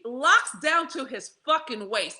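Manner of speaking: ordinary delivery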